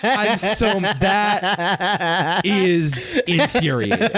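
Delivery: slow, high fury through laughter; hammed-up rage breaking into laughs; laughing mock fury.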